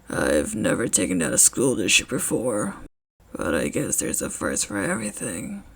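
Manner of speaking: a quiet menacing promise; low grim man voice. Acted. Eraserhead, grim.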